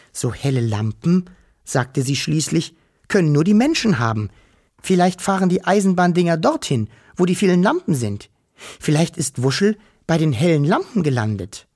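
high, hopeful little Wischel; eager little creature, voice up; small creature voice. Acted. Wischel character voice.

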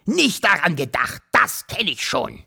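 grumpy uncle's scornful mimicry; sour, grumbling put-on voice; grunting. A narrator's reading. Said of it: "grunzte der Wawuschelonkel".

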